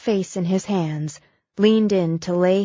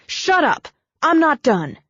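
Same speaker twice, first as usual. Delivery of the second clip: sharp cutoff; 8 semitones higher, 4 dB louder than usual. Acted. Brooke cutting her off.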